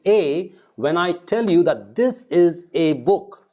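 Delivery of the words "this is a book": "this is a book" with "a" as a stressed AY; emphasized A. Shown as a demonstration. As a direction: lean on the A, letter-name sound. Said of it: "I want to emphasize what book".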